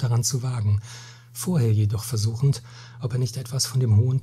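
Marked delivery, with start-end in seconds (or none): none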